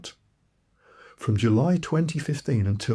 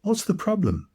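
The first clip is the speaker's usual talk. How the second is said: insistent question, pitched up; 10 semitones higher than usual. A narrator's reading. She presses the reluctant man for an answer, insistent. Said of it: "she insisted".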